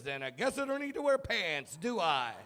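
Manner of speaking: put-on a kid who thinks he won; smart-aleck kid. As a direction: son smugly arguing against pants. Son's smug reply.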